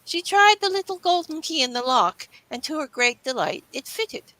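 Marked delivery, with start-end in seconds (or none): none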